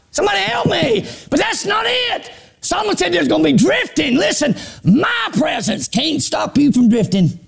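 high, shouted sermon call; preaching at full force. Acted. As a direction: preach it out to the church.

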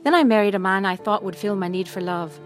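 normal talking voice

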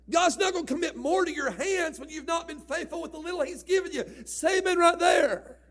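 loud, high, sung-out preaching; fervent preaching. Acted. Preacher exhorting the church to say amen, loud and sung. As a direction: whoop it up at the congregation.

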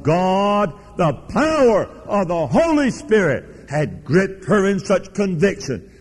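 high, emphatic pulpit proclamation; fervent preaching. Real, not acted. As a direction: proclaim it with force, stretching the key words. Preacher drives the point home.

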